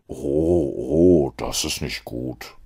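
low, slow, rueful Holmes; a dry detective mildly regretting his deduction. A narrator's reading. Holmes to Mortimer, low and rueful.

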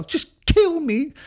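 high, drawn-out mock-desperate cry; hammed-up, slow wail; melodramatic plea. Imitates her plea again.

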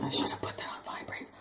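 hushed, whispered self-scolding; whispered aside. Real, not acted. Apologizing aside for phone left on.

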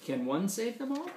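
plain reading voice

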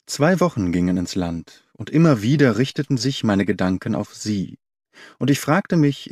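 ordinary delivery

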